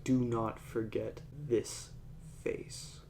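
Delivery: softly delivered menacing line; quiet threat. Retold or imitated. Film fighter warning his enemy, quiet threat.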